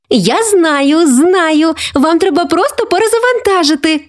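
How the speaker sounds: put-on high, excited young girl's voice; eager exclamation. Granddaughter rushing to fix grandma's Wi-Fi, excited exclaiming. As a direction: jump in like a know-it-all kid. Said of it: "вигукнула вона".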